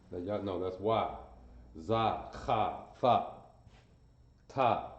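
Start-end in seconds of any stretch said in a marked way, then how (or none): none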